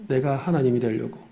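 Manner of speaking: ordinary delivery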